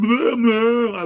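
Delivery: high, loud mocking blah blah; mock high squeaky babble. Mocking the skinny guy who came at him, high and loud. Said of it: "He said".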